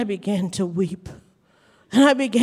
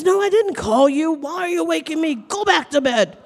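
mildly irritated, raised-pitch retelling; lightly voiced grumpy scolding; annoyed uncle; 6 semitones higher, 3 dB louder than usual. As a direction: act out a mildly cross uncle woken up. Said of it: "you were mad at me".

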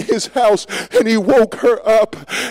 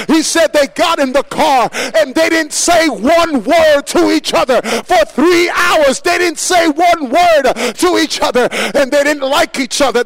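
preaching at full force; 5 semitones higher than usual. Acted. Preacher building to a climax.

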